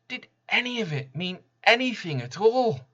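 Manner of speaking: high, loud, breaking plea; anguished question. A narrator's reading. People left asking if anything mattered, anguished cry. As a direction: cry out the question as if all meaning has gone. Despairing question the horseman leaves.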